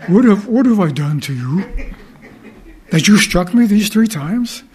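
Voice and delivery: a hammed-up talking donkey, hurt and puzzled; voicing the donkey. Donkey pleads with Balaam.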